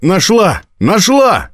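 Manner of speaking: high, breathless-with-news exclamations; excited announcement. A narrator's reading. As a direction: burst in with good news, pitched high. Girl rushes in with news.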